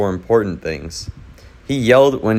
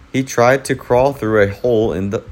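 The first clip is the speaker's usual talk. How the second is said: usual speaking voice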